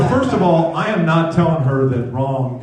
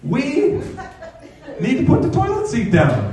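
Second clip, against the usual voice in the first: very high-pitched mock wifely demand; imitated wife; 13 semitones higher than usual. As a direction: mimic the wife's "we", pitched far above your own voice. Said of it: "she'll say things like".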